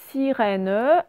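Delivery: SIRÈNE as SI-RÈ-NE; teacher separating the syllables of SIRÈNE; split into syllables. Shown as a demonstration. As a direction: sound out SIRÈNE, final E voiced. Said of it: "Première syllabe".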